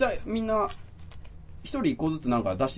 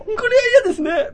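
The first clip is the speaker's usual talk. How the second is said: pitched-up, louder mock Takeshi voice; over-the-top Takeshi read, higher and louder; Beat Takeshi impression; 11 dB louder than usual. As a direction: ham up a Takeshi radio bit, high and loud. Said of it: "高えな声が".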